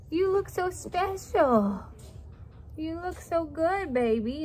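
high, slow, cooing praise; doting owner cooing up high and slow. Real, not acted. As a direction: gush over the dog in a high drawn-out coo. Cooing over pet in collar.

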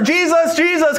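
high, urgent repeated call; mild mock yell. Blind beggar calls as Jesus passes by, mild mock yell. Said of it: "he just yells out all the louder".

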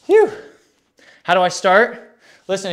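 normal talking voice

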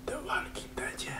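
spooky whisper. Pretend. Spooky whispered game title.

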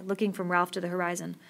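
usual speaking voice

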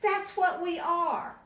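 bright high little bear voice; high baby bear voice. Acted. Parent reading to a child, high voice for the baby bears. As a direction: speak up high as small cubs.

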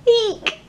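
high startled eek; fearful squeak. Acted. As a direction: squeal as if something scary jumped out. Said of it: "my heart is afraid".

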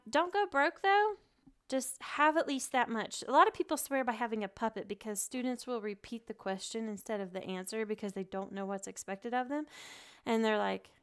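ordinary talk

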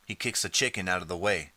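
plain reading voice

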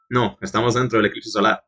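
low, quiet, composed voice; lightly voiced stern stranger; cool mysterious swordsman. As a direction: talk down to the boy calmly. Voices Arikado.

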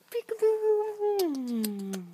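high, slow, excited peekaboo. Real, not acted. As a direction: stretch it out with a playful gasp. Playing peekaboo.

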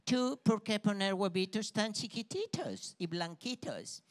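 put-on slow broken Spanish with an American accent; American hen mocking the Cuban hen, in an American accent; gringa hen accent. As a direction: talk down like a snooty gringa hen. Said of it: "le dice una de esas gallinas gringas".

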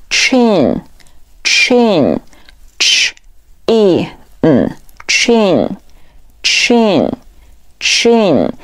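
"chin" slowly, split into "ch", "e", "嗯", then blended; slow sounding out. Shown as a demonstration. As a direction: model "chin" whole, in separate sounds, then whole. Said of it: "边看视频边跟读". (慢速拆读并拼读chin供跟读)

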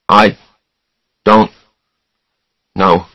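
plain reading voice

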